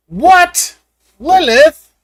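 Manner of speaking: sudden high, loud calling out; startled shout. Real, not acted. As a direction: yelp a name in surprise.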